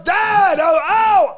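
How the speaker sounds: yelling out as the blade hits, high and loud; pained shout. Retold or imitated. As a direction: cry out in sudden pain. Son stabbed grabbing dad.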